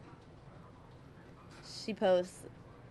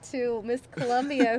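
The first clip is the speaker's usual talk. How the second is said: usual speaking voice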